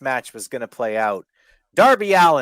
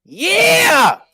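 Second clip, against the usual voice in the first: high, loud whooping yell; wild yell; 11 dB louder than usual. Retold or imitated. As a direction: holler it with full hype. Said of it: "Mark was like".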